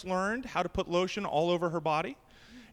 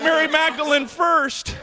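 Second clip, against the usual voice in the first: rowdy friends heckling; 7 semitones higher, 7 dB louder, slower than usual. Retold or imitated. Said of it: "They're like".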